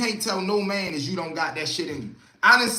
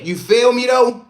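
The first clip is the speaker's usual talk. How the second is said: loud call-out; 5 semitones higher, 10 dB louder than usual. Real, not acted. Repeating a question louder for chat.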